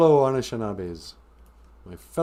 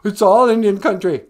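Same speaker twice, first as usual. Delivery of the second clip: mock whine; 6 semitones higher, 8 dB louder than usual. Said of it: "bitter crybabies who go".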